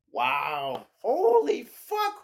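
high, drawn-out astonished cry; stunned amazement. Real, not acted. Fan watching his team's playoff game, stunned.

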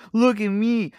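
high, smug mock brag; mock show-off. Mocking manual drivers, smug show-off. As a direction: sneer it like a show-off. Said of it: "people are like".

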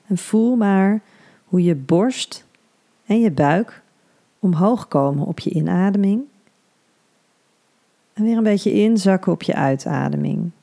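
soothing hypnobirthing guide; guided meditation. Acted.

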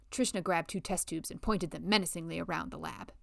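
plain reading voice

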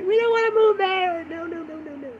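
high whiny refusal, fading away; mock dread. Refusing to move to a hellhole country, mock dread. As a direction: squeal it in pretend panic, trailing off.

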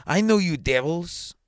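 high, loud, slow accusation; accusing. A narrator's reading. Superintendent turning on the goldsmith, loud accusing.

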